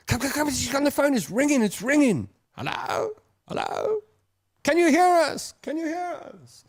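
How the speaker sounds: high-pitched, excited calling; excited phone-call re-enactment. Acted. Family crowding round an overseas call, acted high and excited. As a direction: squeal it high, as on a long-distance line. Acts out old long-distance family calls.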